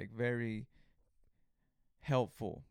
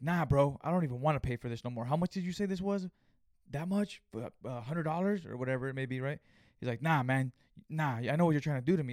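angry outburst; 2 semitones higher, 4 dB louder than usual. Retold or imitated. Customer angrily refuses to pay.

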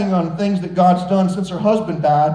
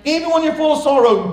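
full preaching; 6 semitones higher than usual. Acted. Preaching climax.

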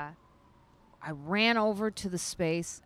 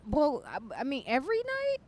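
disbelief; 8 semitones higher than usual. Retold or imitated. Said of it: "And so you're like".

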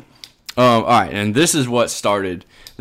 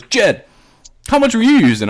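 pointed question; 12 semitones higher, 5 dB louder than usual. Retold or imitated. Friend asks pointed question.

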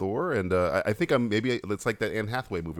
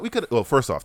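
usual speaking voice

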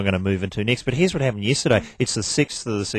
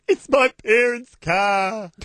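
higher, slow mock young woman's voice; mocking the young driver; 13 semitones higher, much slower than usual. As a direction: whine it out like a spoiled young driver caught out. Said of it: "Her first words were".

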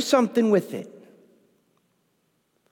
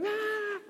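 put-on frustrated child cry; 14 semitones higher, 12 dB quieter than usual. Kid throwing a fit.

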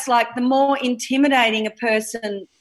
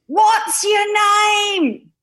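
put-on child voice; 11 semitones higher, 3 dB louder, slower than usual. Child asking stranger.

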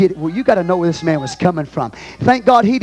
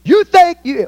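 high, loud outburst as the apostle; indignant challenge; 13 semitones higher, 7 dB louder, slower than usual. Acted. Apostle challenging his rivals, indignant and loud. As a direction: fire it out like a challenge.

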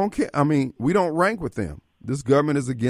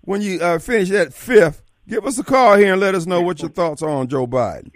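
mocking call-out; 6 semitones higher, 5 dB louder than usual. Real, not acted. Host calls out to a caller.

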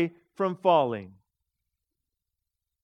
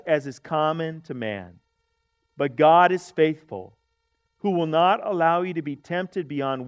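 scripture reading; 4 semitones lower than usual. Shown as a demonstration. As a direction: read it aloud with the congregation. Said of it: "I want you to read that with me".

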